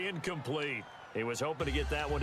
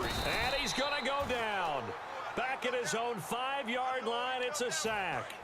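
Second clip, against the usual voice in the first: excited; 6 semitones higher than usual. Real, not acted. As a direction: pitch jumps up for the sack. Sack call in play-by-play.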